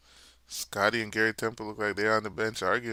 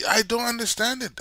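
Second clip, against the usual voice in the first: exasperated; 11 semitones higher, 6 dB louder than usual. Real, not acted. Exasperated at coach's choices.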